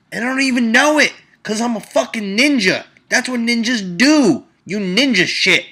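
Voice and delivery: loud, high, racing brag about being a ninja; hyped ninja rant. Acted. Bragging to listeners he's a ninja, loud and high. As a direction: puff up and shout it like a self-proclaimed ninja.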